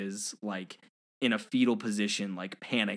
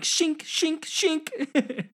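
high-pitched mouthed throwing noises; imitated disc sounds; much slower than usual. Buzz throwing discs at Zurg, high imitated whooshing shinks. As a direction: make each disc ring out high. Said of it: "grabbing a couple discs and be like".

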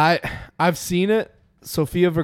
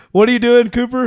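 calling out; 8 semitones higher, 7 dB louder than usual. Real, not acted. Calling out to Cooper.

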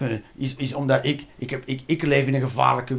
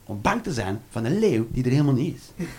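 ordinary delivery

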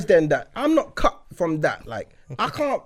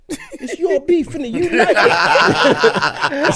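high, slow and cracking up with laughter; words barely holding through laughter; laughing through it; 9 semitones higher, 6 dB louder, much slower than usual. Retold or imitated. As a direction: push the dare while giggling. Daring his mate to light it.

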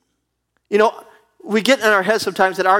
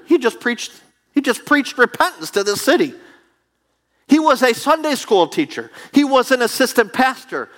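higher, rising preacher's cadence; preaching build; 6 semitones higher than usual. Acted. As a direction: stack each claim higher. Builds his point.

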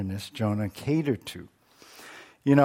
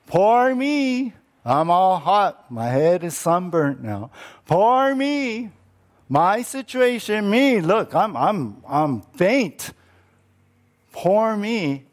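high, whiny poor-me lament; self-pitying whine; 5 semitones higher than usual. Acted. Preacher mocking Jonah's self-pity, whiny poor-me voice. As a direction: wallow in self-pity, hammed-up. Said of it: "his self-centeredness".

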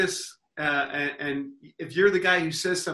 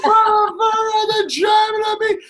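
mock the enraged player screaming back; furious player; 8 dB louder than usual. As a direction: player losing it at the heckler, hammed-up shout. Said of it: "he's like".

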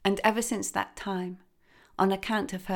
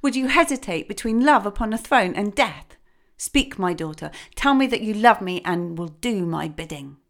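outraged demand; 3 semitones higher, 7 dB louder than usual. A narrator's reading. Father king demanding obedience, loud and outraged. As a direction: shout down her hesitation. King confronts his defiant daughter.

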